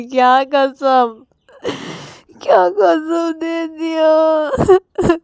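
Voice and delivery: slow, high, sobbing question; crying. Retold or imitated. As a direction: wail it out through tears. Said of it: "Ravi ये बोलकर रो देता है". (रोते हुए धीमे से पूछना)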